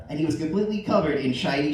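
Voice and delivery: plain reading voice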